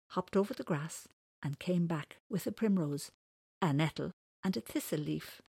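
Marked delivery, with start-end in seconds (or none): none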